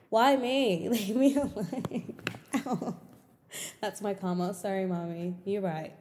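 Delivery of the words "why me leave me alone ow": drawn-out, playful complaint; mock exasperation. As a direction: plead to be left alone, hammed up. Mock complaint about fixing brother's mess.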